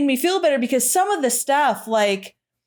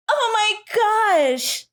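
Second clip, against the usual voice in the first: a hammed-up melodrama heroine, higher and slow; overacted; 6 semitones higher, slower than usual. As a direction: ham it up like a stage actress in a slasher. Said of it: "it's so over the top".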